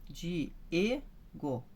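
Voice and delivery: "Diego" split into di, E, go, with E lifted; stress on E. Shown as a demonstration. Teacher repeating a name for the class, "Diego" split, E stressed. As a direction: break "Diego" into syllables, stressing the E. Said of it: "então o E que é o forte".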